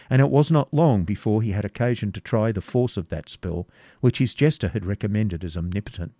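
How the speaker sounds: usual speaking voice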